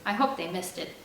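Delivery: ordinary delivery, nothing marked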